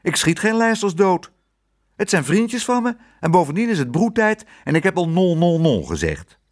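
high, indignant protest; called-out protest. A narrator's reading. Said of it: "riep Tos".

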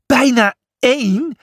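"Bijna één?" rising high in disbelief; a storybook double take; incredulous. A narrator's reading. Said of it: "Je zit met de dolle".

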